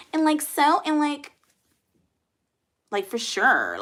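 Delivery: tossing out likes in a mock ditzy Valley girl voice; Valley girl impression. Mocking a viewer's Valley girl comment, light mock Valley girl. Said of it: "I thought Valley girls are like".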